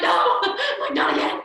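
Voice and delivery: high, loud hammed-up protest breaking into laughter; mock dismay. Teacher reliving the moment the toddler cries again, mock dismay. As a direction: wail it in comic despair. Acting her dismay at renewed crying.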